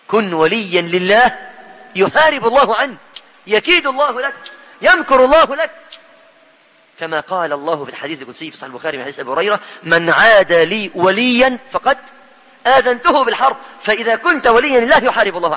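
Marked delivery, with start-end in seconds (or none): 0.1-5.7 building sermon climax. Acted. Preacher assuring believers that God fights for them, building climax. 9.8-15.6 solemn forceful recitation. Acted. Preacher quoting the divine warning of war, solemn rising voice.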